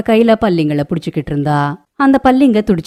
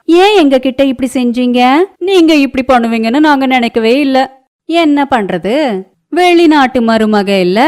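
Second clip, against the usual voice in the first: high, loud, teasing neighbour woman voice; a gossiping neighbour poking fun, pitched high; nosy neighbour; 8 semitones higher, 4 dB louder than usual. Acted. (பக்கத்து வீட்டுப் பெண் கிண்டலாக, உயர்ந்த குரலில்)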